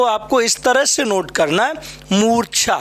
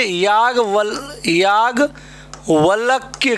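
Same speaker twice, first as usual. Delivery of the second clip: Yajnavalkya said slowly, syllable by syllable, as the mistake; slow demo of the wrong form; much slower than usual. Shown as a demonstration. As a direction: spell out Yajnavalkya slowly like a learner's error. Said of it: "ये आपने कर दिया याज्ञवल्क्य". (याज्ञवल्क्य को धीरे-धीरे, गलत रूप में बोलें)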